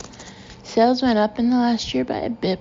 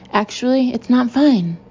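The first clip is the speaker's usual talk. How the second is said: louder, rising indignation; indignant; 3 semitones higher, 5 dB louder than usual. Acted. Boss turning back on giggling staff, indignant. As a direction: stop holding it in and object firmly. Angry narrator turns on teasing staff.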